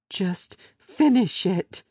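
woman begging him to end it; despairing plea. Acted. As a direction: push it out in despair.

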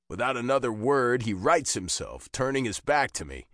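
usual speaking voice

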